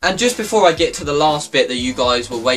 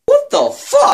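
much higher and louder shocked yelp; shocked exclamation; 6 dB louder, slower than usual. Real, not acted. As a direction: react in real shock at the sound. Hearing his knee click.

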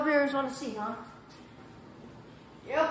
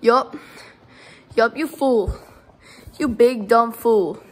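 louder, gloating trash talk; loud taunting; 2 semitones lower, 7 dB louder than usual. Acted. Player gloating at the beaten wrestler, loud and mocking. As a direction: rub it in, big and loud.

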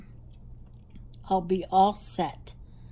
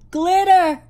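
"glitter" in a Jersey accent, higher and louder; 8 semitones higher, 8 dB louder than usual. Shown as a demonstration. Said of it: "That's my Jersey accent".

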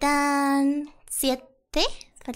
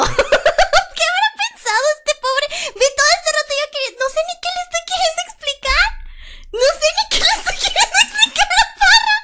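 high, loud talk broken by laughter; giggly embarrassed laughter; laughing through words; 10 semitones higher, 5 dB louder than usual. Real, not acted. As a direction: speak while cracking up. Laughing at own drunk clip.

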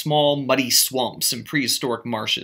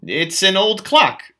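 higher, slow and a bit louder; idiotic remark; 7 semitones higher, 5 dB louder, slower than usual. A narrator's reading. Narrator blurting to Gatsby, slow idiotic remark. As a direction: blurt it out foolishly. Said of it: "I told him idiotically".

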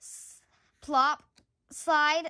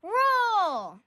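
louder rising call to roll; crowing call; 5 semitones higher, 5 dB louder, much slower than usual. Acted. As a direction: crow out the start like a race starter. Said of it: "crowed Rooster".

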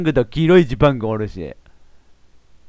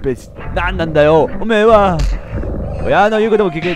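louder, higher mock scolding; mock anger; 5 semitones higher, 4 dB louder than usual. Scolding the parent character, mock angry yell. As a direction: yell back in playful outrage. Player answers the character angrily.

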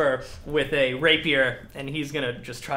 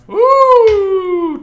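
long, high, loud woo; excited whoop; 16 dB louder than usual. Real, not acted. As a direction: whoop at a lucky roll. Reacts to a twenty roll.

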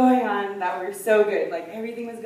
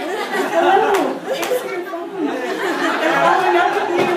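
much higher, louder, fed-up call; exasperated shout; 10 semitones higher, 9 dB louder, slower than usual. Retold or imitated. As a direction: yell at people who won't pick up. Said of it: "I'm like".

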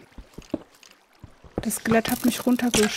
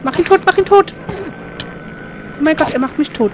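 panicked shouting; 5 semitones higher, 6 dB louder than usual. Real, not acted. Panicked fight against monster.

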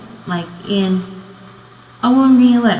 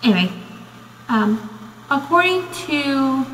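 ordinary delivery

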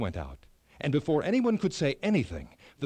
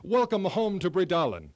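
louder welcoming cheer; cheering crowd; 4 semitones higher, 7 dB louder than usual. A narrator's reading. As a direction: call out the welcome. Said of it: "the people cheered".

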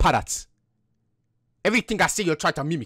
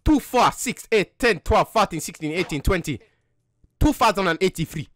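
put-on African accent; slower than usual. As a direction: speak in a mock African accent. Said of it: "so you don't have an African accent".

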